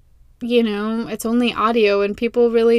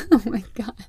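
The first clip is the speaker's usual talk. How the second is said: real laughter through the words; laughing. Real, not acted. Vlogger laughing at her own Linda bit, genuinely laughing. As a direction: crack up at your own joke. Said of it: "I'm laughing because I'm hilarious".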